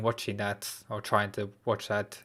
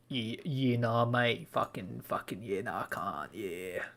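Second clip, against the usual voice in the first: exaggerated Australian slang, a lazy Australian accent; mock Australian accent; 6 dB quieter, slower than usual. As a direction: ham up an Australian bloke's accent, softly. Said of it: "Say something in an Australian accent".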